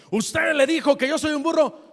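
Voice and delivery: high, loud indignant challenge; angry officer. Retold or imitated. Offended officer demanding answers from the airline lady, indignant. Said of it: "el poli estaba medio enojado".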